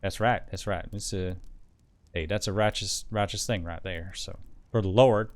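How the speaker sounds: put-on folksy Southern accent; righteous Southern US accent. Agreeing for the Lord, in a Southern accent.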